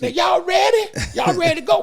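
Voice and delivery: high, loud shouted impatience; impatient driver voice. Retold or imitated. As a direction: call out high like the fed-up driver. Driver impatient after gig.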